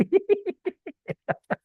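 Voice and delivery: high-pitched giggle; real tickled laughter; amused laugh. Real, not acted. Laughs at someone yawning.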